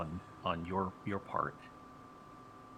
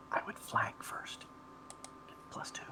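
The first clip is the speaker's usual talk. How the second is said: whispering; 5 dB quieter than usual. Real, not acted. DM aside, suggesting a tactic.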